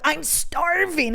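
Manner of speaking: hammed-up hunger, moderately stretched; hungry complaint. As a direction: complain of hunger with a long, high STARVING. Redoes her starving complaint.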